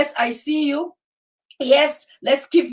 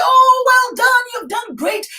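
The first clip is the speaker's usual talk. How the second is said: flattering praise; 10 semitones higher, 4 dB louder than usual. Retold or imitated. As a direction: gush like a flatterer. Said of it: "Even if you come and say".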